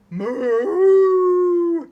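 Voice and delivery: big high booming moo; loud cow moo. Acted. Cow moos loudly right in the farmer's face, booming. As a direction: bellow like a cow in his face.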